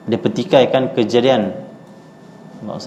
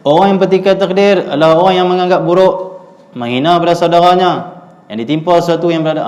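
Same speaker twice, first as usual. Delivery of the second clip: loud, higher recital of the text so the whole house hears; reading aloud loudly; 5 semitones higher, 4 dB louder than usual. Retold or imitated. Grandfather reading his book loudly so wife and children hear. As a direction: read it out loud and high like grandfather at home. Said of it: "dia akan baca kuat-kuat".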